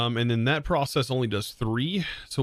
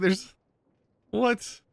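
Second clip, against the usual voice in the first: surprised; 11 semitones higher than usual. Retold or imitated. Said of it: "I was like".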